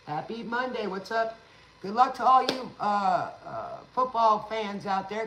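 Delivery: loud, high hype call to the fans; rally the football fans out there; excited shout-out. Real, not acted.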